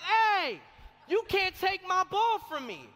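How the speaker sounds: high-pitched, defiant retold challenge; standing up to bully. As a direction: act out a kid standing up for himself. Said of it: "thinking about the words of my father".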